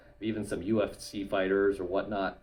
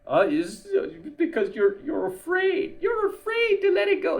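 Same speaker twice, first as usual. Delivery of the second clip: high, whiny taunting voice, climbing higher; mocking accuser voice; 4 dB louder than usual. Mocking blue pill critics with a high, whiny taunt. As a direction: mimic a sneering critic saying you're scared. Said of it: "the blue pill are gonna accuse you of".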